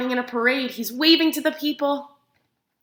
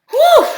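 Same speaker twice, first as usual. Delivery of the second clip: high, loud exhausted whew; worn-out duck's big whew; 10 dB louder than usual. Acted. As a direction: blow it out like you're worn out. Duck exhausted from running state.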